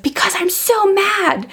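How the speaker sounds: put-on high, slow little-kid protest; upset small child. Daughter explaining herself to her mother, high childish whine. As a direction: imitate a mad three-year-old, stretching the words. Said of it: "she was like".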